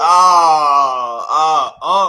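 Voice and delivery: drawn-out loud "ah" yells, calling everyone's attention; loud hype yell. Real, not acted. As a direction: shout "ah" twice to hype the room. Hyping the call-out.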